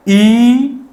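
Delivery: "ई" drawn out as eee; long vowel. Shown as a demonstration. Teacher repeating the longer sound, stretched "ई". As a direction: hold "ई" long, rising slightly. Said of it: "थोड़ी ध्वनि जो है लंबी जाएगी".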